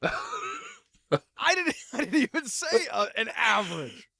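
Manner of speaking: laughing protest. Real, not acted. Defending himself, laughing.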